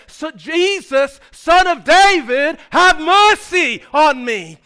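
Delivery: high, loud, drawn-out plea. Retold or imitated. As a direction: cry out slowly over the hecklers. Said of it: "his voice gets louder and louder".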